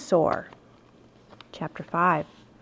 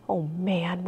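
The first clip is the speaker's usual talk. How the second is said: hushed, softer awed words; whispered; 3 semitones lower, 9 dB quieter than usual. A narrator's reading. Boy seeing a dinosaur from the treehouse, hushed awe. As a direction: breathe it out in awe. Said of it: "whispered Jack".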